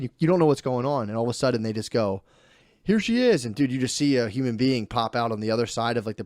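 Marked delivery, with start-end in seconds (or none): 2.8-3.4 high, bright announcing voice; cheery surgeon. Retold or imitated. Surgeon presenting the newborn to the father, high and cheery. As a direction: sing it out like presenting the baby.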